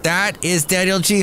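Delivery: normal talking voice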